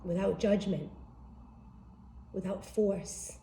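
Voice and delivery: soft, unhurried meditation guide voice; guided meditation. Acted.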